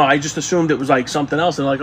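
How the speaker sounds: ordinary talk, nothing marked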